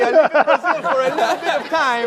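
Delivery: loud, high cartoon Italian; mock Italian accent. Host playing an Italian from Brazil, mock Italian accent. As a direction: ham up a silly Italian accent. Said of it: "He's Italian accent".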